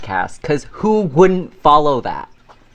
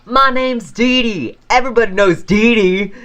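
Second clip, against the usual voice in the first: crackhead lady caricature; 8 semitones higher, 5 dB louder than usual. Retold or imitated. Crackhead lady introducing herself at the door, hammed-up proclamation. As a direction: announce yourself like a local legend, hammed-up. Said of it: "some crackhead".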